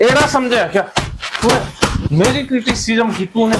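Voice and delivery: punchy insults in separate bursts; high-pitched venting, one jab at a time; cathartic cursing. Acted. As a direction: spit out each insult at the imagined critic. Models venting abuse at critics.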